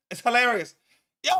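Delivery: ordinary talk, nothing marked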